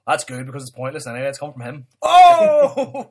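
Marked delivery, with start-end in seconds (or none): 2.0-3.1 sudden shout of surprise; loud surprised cry. Real, not acted. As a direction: blurt it out loudly.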